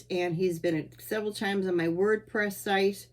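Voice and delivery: ordinary talk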